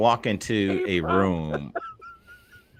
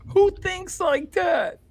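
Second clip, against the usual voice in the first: high, slow disbelief; can't believe anyone thinks that; incredulous; slower than usual. Real, not acted.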